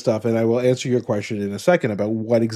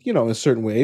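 usual speaking voice

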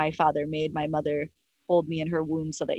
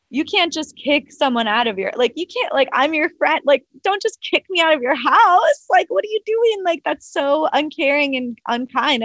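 high, louder, retold indignation; upset protest; 8 semitones higher, 4 dB louder than usual. Girlfriend protesting to boyfriend kicking her out, upset. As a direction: plead and scold, pitch climbing steeply.

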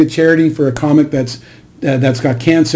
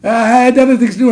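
dismissive shrug-off; 9 semitones higher, faster than usual. Retold or imitated. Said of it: "And Artie's just like".

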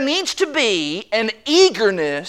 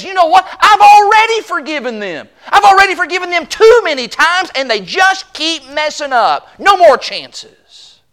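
higher-pitched mock exasperation, tired of giving chances; fed-up grudge holder; 7 semitones higher than usual. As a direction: play the spouse who's done forgiving, a bit hammed-up. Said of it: "the attitude that says".

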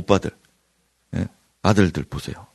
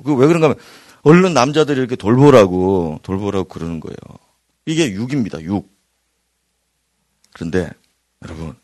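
ordinary talk

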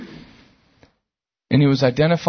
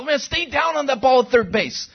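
coach yelling; 10 semitones higher than usual. Retold or imitated. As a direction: bark it across the infield like a coach. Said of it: "he goes".

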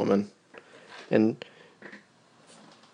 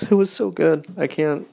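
giddy; 6 semitones higher than usual. Real, not acted. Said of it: "thinking about it and giggling".

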